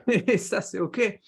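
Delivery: normal talking voice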